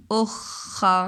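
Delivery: "अखा" stretched very slowly with a held ख; very drawn-out demo. Shown as a demonstration. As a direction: hold the ख long. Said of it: "next word क्या है". ("अखा" को बहुत धीरे, ख दबाकर)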